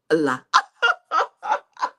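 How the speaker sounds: loud cackle; real laugh at her own folly; burst of laughter. Real, not acted. Laughing after saying wahala.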